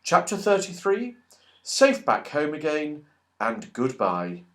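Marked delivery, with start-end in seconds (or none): none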